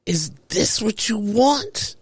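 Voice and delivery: disgusted. Retold or imitated. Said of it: "he said it like".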